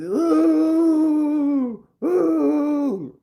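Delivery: high falsetto wailing oh; mock sob. The sobbing neighbor during the hug, in a high wail. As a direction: wail the oh through fake tears.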